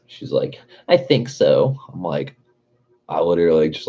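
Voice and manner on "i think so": high, breathy mock of the other driver; mocking ditzy girl voice. Mocking the driver who hit his car, high girly voice. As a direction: whine it like a clueless girl.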